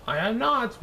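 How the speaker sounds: high, nervous denial; gulped. Acted. Regina gulps and denies copying Natalie, high and nervous. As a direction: squeak it out in a panic. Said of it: "Regina gulped".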